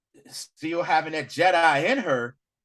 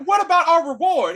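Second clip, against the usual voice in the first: high, louder eager question; mock eager demand; 11 semitones higher, 7 dB louder than usual. Brother asking for his reward, high and eager. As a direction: ask it greedily, up high. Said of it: "She's like".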